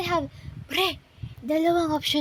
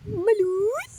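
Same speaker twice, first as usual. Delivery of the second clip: balut vendor cry; 7 semitones higher than usual. Pretend. Calling out balut for sale.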